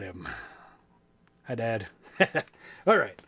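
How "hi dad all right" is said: ordinary delivery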